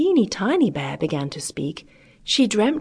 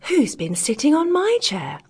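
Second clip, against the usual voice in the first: higher-pitched middle bear; middling-sized bear voice; 9 semitones higher than usual. A narrator's reading. Middling-sized bear finds chair used, crying out in higher voice. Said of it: "cried in a middling-sized voice".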